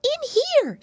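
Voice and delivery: very high squeaky call; little mouse calling urgently; crying out. Acted. Mousy girl beckons the piggy boys, high squeaky cry. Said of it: "she cried".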